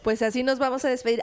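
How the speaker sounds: usual speaking voice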